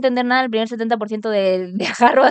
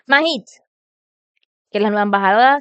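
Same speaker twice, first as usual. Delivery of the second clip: ordinary delivery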